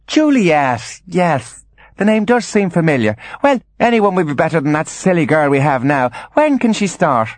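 high, musing grand lady; ponder, then decide in a high register; high-pitched airy mother voice; Angeline, vague mother. Acted.

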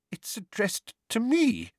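cling to the letter, scared; shaking voice. A narrator's reading. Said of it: "in a shaking voice".

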